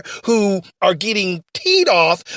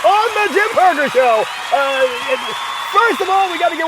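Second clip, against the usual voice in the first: radio host; 8 semitones higher than usual. Acted. Host persona greeting listeners.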